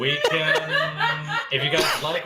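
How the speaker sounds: laughing while talking. Real, not acted. Laughing through words.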